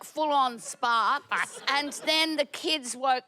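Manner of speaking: ordinary delivery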